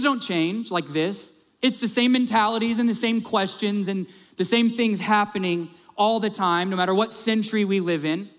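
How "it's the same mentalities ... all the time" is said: normal talking voice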